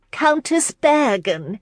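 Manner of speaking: high, drawn-out hostess call. A narrator's reading. Hostess hails a guest across the party, calling out. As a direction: hail her slowly in a high voice.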